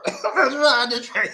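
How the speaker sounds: high-pitched words through laughter; laughing. Real, not acted. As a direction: say it while cracking up. Cracks up at his own chant.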